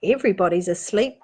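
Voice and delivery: plain reading voice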